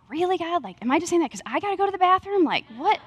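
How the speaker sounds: retold incredulous protest; incredulous questioning. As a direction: imitate baffled disbelief at God. Questioning God about an odd picture.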